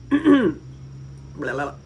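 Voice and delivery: usual speaking voice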